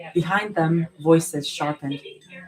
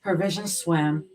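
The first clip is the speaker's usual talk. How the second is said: ordinary delivery, nothing marked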